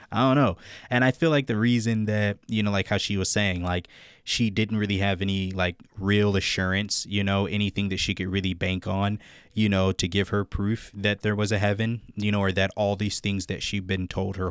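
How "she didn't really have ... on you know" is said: normal talking voice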